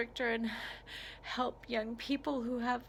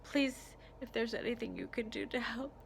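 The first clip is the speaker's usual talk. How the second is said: usual speaking voice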